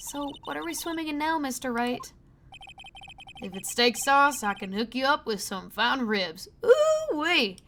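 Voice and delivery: cowboy joking, ending in a high, loud whoop; lively cowboy. Acted. Cowboy cop ribbing the lawyer, whooping. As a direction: hoot it out like a rodeo cowboy.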